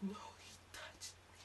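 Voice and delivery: put-on high girly whisper; whispered girlfriend. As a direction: breathe it out timidly.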